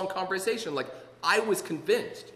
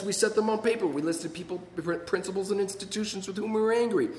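ordinary talk, nothing marked

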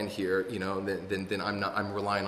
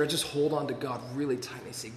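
normal talking voice

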